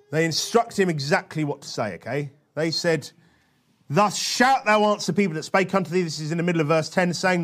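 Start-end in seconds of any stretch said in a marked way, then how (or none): 3.9-6.0 weighty reading, key words punched; emphatic scripture reading. Acted. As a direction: read it out like a bold proclamation.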